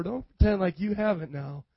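ordinary talk

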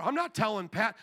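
ordinary talk

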